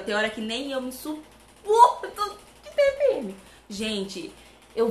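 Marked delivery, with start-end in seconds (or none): 0.0-3.4 loud, slow wail climbing high; hammed-up exasperation. Real, not acted. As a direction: whine it out in mock despair. Desabafo exagerado sobre a TPM. (desespero exagerado, voz chorosa e aguda)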